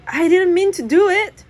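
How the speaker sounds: high loud blurt. Acted. Jack blurting guilt to Dad on the phone, high and loud. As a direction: spill it out in a panic. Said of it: "I blurt out".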